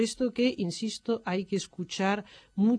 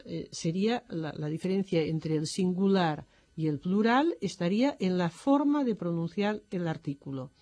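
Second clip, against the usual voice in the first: ordinary talk, nothing marked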